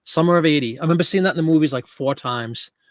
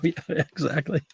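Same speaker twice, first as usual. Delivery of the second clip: ordinary talk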